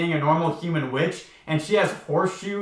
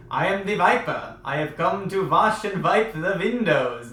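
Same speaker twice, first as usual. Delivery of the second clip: put-on horrible German accent; 2 semitones higher than usual. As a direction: do a bad mock German accent, pitched up. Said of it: "probably a horrible German accent".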